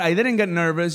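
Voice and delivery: ordinary talk, nothing marked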